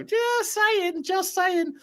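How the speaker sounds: very high falsetto "just saying"; teasing aside. Acted. Host teasing that Stel is always right, high falsetto. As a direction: squeak it out in a tiny falsetto.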